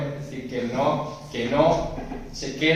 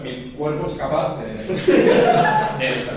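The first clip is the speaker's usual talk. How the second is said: breaking into laughter; laughing; 2 semitones higher, 6 dB louder, slower than usual. Real, not acted. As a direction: let the laugh take over. Laughs at candy mishap.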